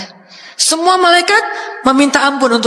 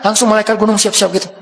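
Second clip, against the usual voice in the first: ordinary talk, nothing marked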